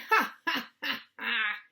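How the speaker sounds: acted burst of "ha ha" laughing; mocking laughter. A narrator's reading. Bully laughing at classmate, hammed-up mocking laugh. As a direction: laugh out loud at them, starting high. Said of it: "Max burst out laughing".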